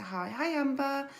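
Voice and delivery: usual speaking voice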